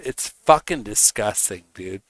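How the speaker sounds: ordinary talk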